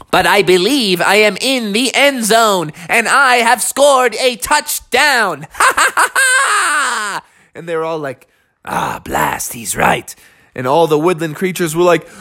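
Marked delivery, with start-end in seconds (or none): 0.1-5.5 high, proud, deliberate proclamation; gloating sloth. Acted. Sloth claims victory over tacklers. 5.6-7.2 high staccato cackle, last note held; gloating villain laugh. Acted. Sloth's triumphant laugh. 8.6-10.1 low, grumbled concession; grudging defeat. Acted. Losers grudgingly admit it.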